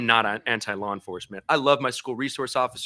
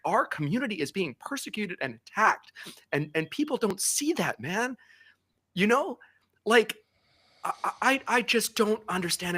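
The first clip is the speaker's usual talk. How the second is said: getting emotional; 6 semitones higher than usual. Real, not acted. Guest tells hosts his community is attacked, rising emotional anger. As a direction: voice climbing higher, close to tears with anger. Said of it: "I don't know why I'm getting emotional".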